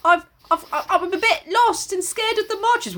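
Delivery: high, hesitant little voice; hammed-up lost girl begging pardon; mock timid plea. Lost woman asking a passing driver for help, mock timid plea.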